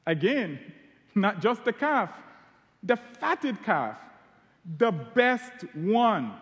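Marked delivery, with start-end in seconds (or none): 1.1-6.3 slow, weighty stress on "fatted" and "best"; emphatic build. Shown as a demonstration. Preacher telling the congregation how lavish the father was, slow emphasis. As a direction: draw out "the best one" to drive it home.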